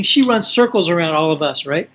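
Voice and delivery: normal talking voice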